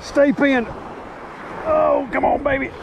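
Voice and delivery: high, pleading stay pinned; urgent plea. Real, not acted. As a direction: beg the fish to stay hooked. Fighting a hooked trout.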